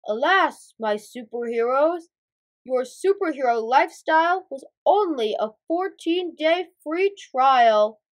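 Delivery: deliberate, theatrical boss voice; Big Boss figure. Acted. Big Boss breaks news to his superheroes, grandly. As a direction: put on a grand mentor's voice.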